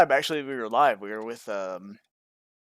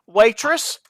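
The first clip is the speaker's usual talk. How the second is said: higher, louder mock-serious diner; 6 semitones higher, 5 dB louder than usual. Diner correcting an order with the waitress, mock serious. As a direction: flag down the waitress, raised and insistent. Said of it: "I can do my more serious voice".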